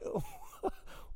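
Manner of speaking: high squeaky burst of laughter; laughing. Real, not acted. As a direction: break into a high delighted laugh. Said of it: "And I'm just laughing".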